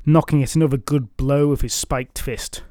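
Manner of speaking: ordinary delivery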